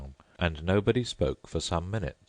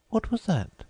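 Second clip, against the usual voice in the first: put-on much higher polite girl voice; Alice's voice. A narrator's reading. Alice thanking the Mock Turtle, high and polite. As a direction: pitch up into a polite young girl.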